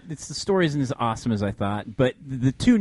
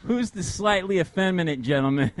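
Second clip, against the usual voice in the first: mock prissy; 5 semitones higher than usual. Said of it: "Except he didn't use those words".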